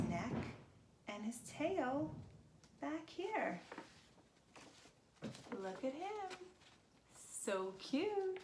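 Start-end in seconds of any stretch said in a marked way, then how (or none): 5.6-8.4 put-on much higher, sweet baby-talk coo; cute cooing. Showing kids the finished giraffe, high cooing. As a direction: coo over it like a cuddly pet.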